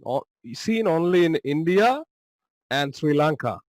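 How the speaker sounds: usual speaking voice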